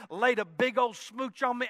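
normal talking voice

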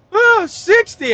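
high, slow, near-crying answer; hammed-up whimper from someone startled awake; mock tearful. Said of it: "he actually cried a little".

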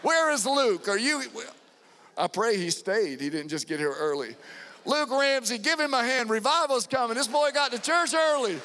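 high, loud shout across the church; calling for Luke, cheering him on; excited calling out. Real, not acted. The pastor calling to Luke Ramsey in the crowd, loud and thrilled.

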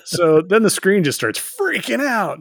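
ordinary talk

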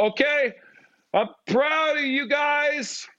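very high, drawn-out mock cheer; hammed-up falsetto-high proud shout; coming on strong. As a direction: overdo it in a high, straining holler. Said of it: "I gotta come strong".

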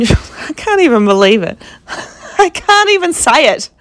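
high laugh breaking through the words; can't get it out for laughing; laughing in disbelief. Real, not acted. Telling viewers about the mother and daughter, real disbelieving laughter.

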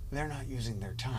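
hushed, quick, laughing aside; quiet aside. Pretend. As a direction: lean in and murmur it. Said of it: "lean over to Josh and go".

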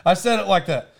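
ordinary delivery

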